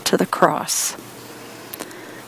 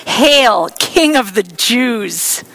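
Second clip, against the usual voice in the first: mocking taunt; 2 semitones higher, 3 dB louder, much slower than usual. Soldiers taunting Jesus, sneering mock hail. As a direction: sneer it like a fake salute. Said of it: "in mockery and taunted".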